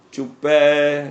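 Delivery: ਚੁੱਪੈ with a long, held open final -ai; drawn-out correct reading. Shown as a demonstration. Preacher shows the congregation how to read the verse, drawn-out ਚੁੱਪੈ. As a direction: say ਚੁੱਪੈ loud and level, stretching the last vowel. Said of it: "ਇੱਥੇ ਚੁੱਪੇ ਨਹੀਂ ਪੜਨਾ".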